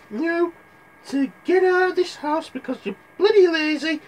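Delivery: high, loud scolding mum; shrill telling-off of lazy sons; mother pig scolding her sons, high and loud; nagging mother pig. Acted. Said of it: "telling her three piggy sons".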